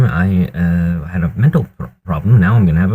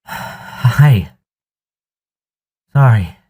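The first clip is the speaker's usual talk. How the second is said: breathless after shoveling; winded, catching breath between words; 5 semitones higher, much slower than usual. Real, not acted. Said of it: "Still a bit out of breath".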